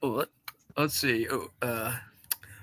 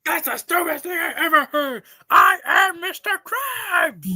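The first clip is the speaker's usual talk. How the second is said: furious shout; 7 semitones higher than usual. Acted. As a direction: scream it furiously. Acted SpongeBob argument.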